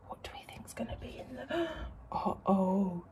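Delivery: gasping suspense whisper; hushed, spooky storytime aside. Real, not acted. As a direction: whisper as if something's hiding.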